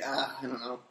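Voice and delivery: usual speaking voice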